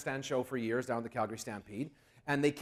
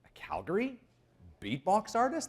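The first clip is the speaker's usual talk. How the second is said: higher, rising pitch of mild disbelief; incredulous question; 5 semitones higher, 4 dB quieter than usual. Retold or imitated. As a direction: question it as if it can't be true. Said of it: "And I'm thinking".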